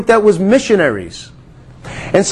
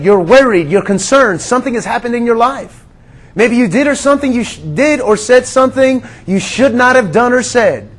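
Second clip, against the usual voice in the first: usual speaking voice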